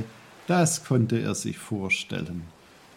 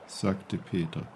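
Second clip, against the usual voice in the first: ordinary delivery, nothing marked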